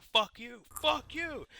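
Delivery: hammed-up drunken shouting match; drunken screaming. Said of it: "just screaming at each other".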